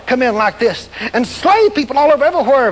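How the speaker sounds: ordinary delivery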